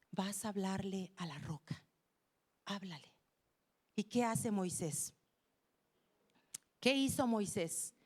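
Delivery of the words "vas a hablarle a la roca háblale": low, soft command; God instructing. Acted. God tells Moses about the rock, quietly instructing. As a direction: give the order quietly, with restraint.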